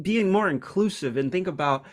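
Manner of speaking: ordinary talk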